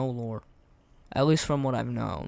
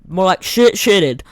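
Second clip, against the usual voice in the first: mocking shout; 4 semitones higher, 10 dB louder than usual. Real, not acted. Mocking dead boss screen.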